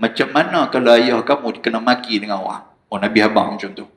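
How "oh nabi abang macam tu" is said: ordinary talk, nothing marked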